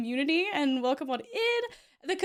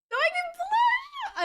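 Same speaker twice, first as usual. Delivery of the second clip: high, slow and flustered; protest, caught out and embarrassed; flustered denial. Real, not acted. Streamer denying she blushed to her idol, flustered and high. Teased by the raider in chat.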